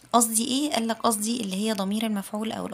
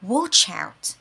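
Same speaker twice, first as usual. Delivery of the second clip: "Watch" hit loud, "out" light; warning, stress on start; 8 dB louder than usual. Shown as a demonstration. Teacher warning a student, stress on "Watch". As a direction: punch "Watch", let "out" fall away. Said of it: "الستريس في كلامي بيبقى على اوله".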